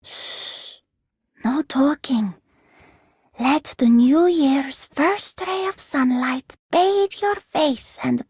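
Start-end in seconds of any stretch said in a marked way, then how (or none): 0.0-2.3 breathy shush then a whispered order; hushing whisper. Acted. Hushing the Doctor before the sunrise, whispering.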